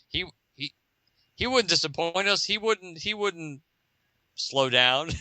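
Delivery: a hint of a banker's hope; hopeful banker. Banker looking up at the green bubble. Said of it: "looks up and goes".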